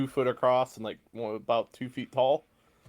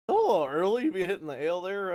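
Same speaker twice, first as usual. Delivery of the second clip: higher, quicker puzzled voice; confused barkeep; 7 semitones higher, faster than usual. Acted. Barkeep to a customer drinking early, confused. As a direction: sound mildly thrown by an early drinker. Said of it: "he's just really confused".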